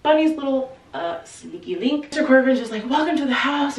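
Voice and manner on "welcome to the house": bright, bouncy greeting pitched up; a jolly host beaming at his guests; bubbly welcome. Retold or imitated. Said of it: "he's super bubbly, he's super jovial".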